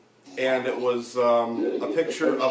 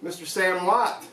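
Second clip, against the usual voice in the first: raised voice calling out to a spirit; 7 semitones higher than usual. Real, not acted. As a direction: call out into the empty room.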